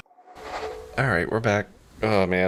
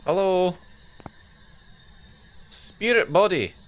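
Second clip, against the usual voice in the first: Scottish accent badly faked, rising high; 7 semitones higher, slower than usual. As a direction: ham up a dodgy Scottish accent. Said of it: "that is a really bad accent".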